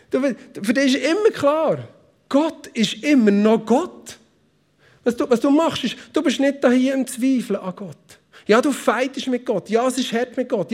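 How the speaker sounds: high, pressing sermon voice; fervent preaching. Acted. Pastor urging the church about fighting with God, fervent. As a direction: drive the point home to the congregation.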